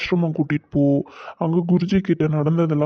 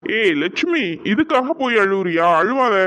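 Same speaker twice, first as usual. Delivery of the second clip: higher, gently chiding voice; chiding husband; 3 semitones higher than usual. Acted. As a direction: tell her off for crying, half teasing. Husband comforts crying wife.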